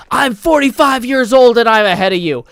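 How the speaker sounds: loud, high imitation of a yelling coach. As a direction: yell it like a coach urging on a runner. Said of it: "he yells at one of the star".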